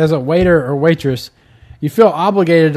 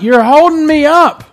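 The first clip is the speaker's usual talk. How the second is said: mock impatient rant; 10 semitones higher, 4 dB louder than usual. Ranting at Redbox browsers.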